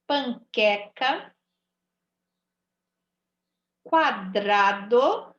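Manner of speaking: each word drawn out slowly syllable by syllable; slow teaching read. Shown as a demonstration. Teacher reading the word list to pupils, slowly. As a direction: stretch the words for children to copy. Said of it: "Tá vendo como eu ouço o som do U?".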